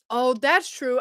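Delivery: ordinary talk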